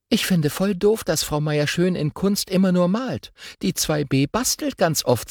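a schoolgirl rattling on about art class; chatty girl voice. Acted. Girl chattering to her twin on the way to school, lively.

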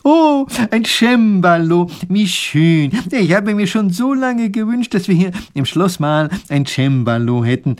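high, loud, overjoyed professor; delighted exclamation. A narrator's reading. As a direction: cry out at a long-wished-for treasure. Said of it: "klatscht erfreut in die Hände und ruft".

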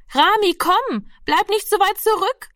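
high-pitched call to a straggler. A narrator's reading. Nurse to the boy lagging behind, calling out. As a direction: call the child along, voice lifted high. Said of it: "rief die Schwester schon wieder".

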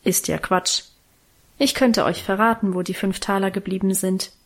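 ordinary delivery

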